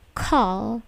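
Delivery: "Call" with a puffed K; plosive K. Shown as a demonstration. Teacher modelling Call for students, aspirated K. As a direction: release the C of "Call" with a burst of air. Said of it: "atau K-nya itu plosif".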